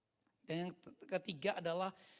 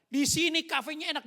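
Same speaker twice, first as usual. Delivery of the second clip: loud, higher-pitched mock enthusiasm; excited sharing; 7 semitones higher, 10 dB louder than usual. Young person broadcasting a good cafe, excited sharing. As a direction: gush as if recommending a favourite spot.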